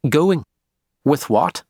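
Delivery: sharp, prickly Northern Irish retort; spiky Belfast accent. A narrator's reading. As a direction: snap it back in Belfast tones. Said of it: "she asked spikily in her Belfast accent".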